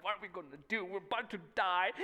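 a touch of freaking out; understated acted alarm, starting to build; mildly acted panic. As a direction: lightly play the worried disciples. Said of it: "they're all freaking out".

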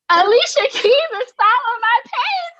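high, loud, slow gasp of disbelief; stunned disbelief. Retold or imitated. Fan discovering [name] follows her, stunned high disbelief. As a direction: say it like you can't believe your eyes. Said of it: "I click on the page and I'm like".

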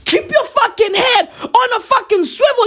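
a high laughing shout of "swivel"; shouted warning. Real, not acted.

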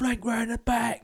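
slow, high mock-military call; mock team orders. Teammate giving imagined paintball orders, high mock command. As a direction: play a squad leader directing a flank.

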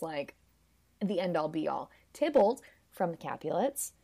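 normal talking voice

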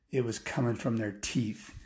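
usual speaking voice

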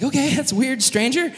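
high, uneasy acted reply; awkward high-pitched brush-off; wary politeness. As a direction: humour a weird stranger. Brothers answering an odd stranger.

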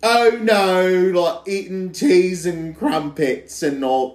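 badly faked British accent. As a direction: do an awful attempt at British. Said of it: "a British accent".

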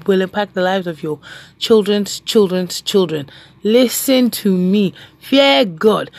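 usual speaking voice

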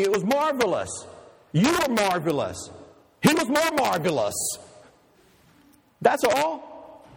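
a hint of a young woman consoling her man; voicing Sybil. Girlfriend Sybil comforting Harold.

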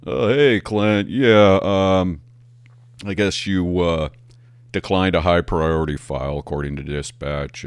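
sergeant voice. Retold or imitated. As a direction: play the awkward sergeant reluctantly passing it on. Sergeant voiced in retold call.

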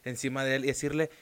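ordinary talk

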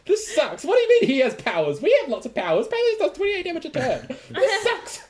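high, loud laughing complaint; mock despair over the boss; excited whining. Real, not acted. Player complains about the boss.

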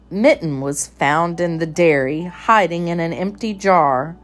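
ordinary delivery, nothing marked

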